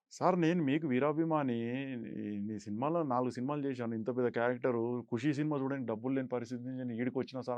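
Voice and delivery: low, soft run-on plea; quietly recounted appeal to an idol; retold humble plea. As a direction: pour out a fan's appeal under your breath. Retelling his plea as a fan.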